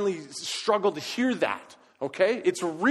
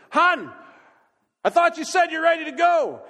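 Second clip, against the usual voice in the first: high, loud impatient holler; loud, high holler with a hint of impatience; hollering again; 10 semitones higher, 9 dB louder than usual. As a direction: shout it through the house to your wife. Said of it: "so I holler again".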